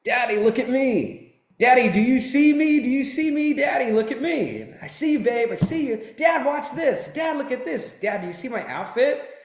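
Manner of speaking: put-on high, eager little girl voice; child calling daddy. Dad retelling his little daughter calling to him, high and eager. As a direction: mimic a four-year-old begging for attention.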